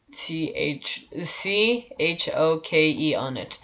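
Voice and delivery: letters spelled slowly one by one. Retold or imitated. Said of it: "I literally spelled it out".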